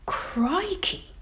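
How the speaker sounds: high, breathy whispered gasp; a startled little gasp; gasped surprise. Acted. Missus T realising the answer, gasped and whispered. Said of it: "gasps Missus T".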